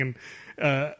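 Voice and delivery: ordinary delivery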